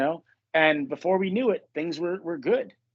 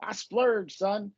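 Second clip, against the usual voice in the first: higher, showy fake-rich bragging; mock baller boast; 6 semitones higher than usual. Joking with a friend about buying a ball, mock boast. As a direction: brag about a cheap ball like it's a fortune. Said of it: "we started talking like that".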